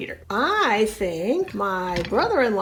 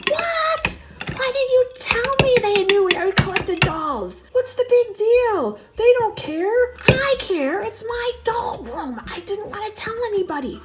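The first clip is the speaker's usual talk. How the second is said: high, urgent, hushed protest; upset whisper fight; 10 semitones higher, 3 dB louder than usual. Acted. Wife whispering at husband about her dolls, frantic. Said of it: "this little whisper fight".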